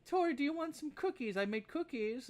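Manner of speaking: put-on high old lady offering cookies; scatterbrained old woman. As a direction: pitch up, a distracted granny. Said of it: "she goes".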